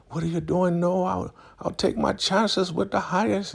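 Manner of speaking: high falsetto protest; mock distressed woman. Elder Mother refusing Zane's help, high mock protest.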